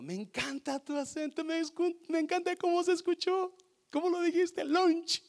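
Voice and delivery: high, gushing mock coworker; high delighted praise. Coworker praising his word lunch, high delighted gushing. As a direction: praise his accent like an excited friend.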